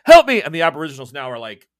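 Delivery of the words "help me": loud, high shout for help; cry for help. Acted. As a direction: call out urgently, much louder and higher. Acted cry for help in game.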